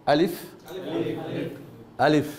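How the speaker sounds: slow letter-by-letter recital; Quranic recitation drill. Shown as a demonstration. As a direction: recite "আলিফ, বা, তা, সা" for the class to repeat. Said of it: "চলুন আমার সাথে এখন". (ধীরে কোরআনি উচ্চারণে পাঠ)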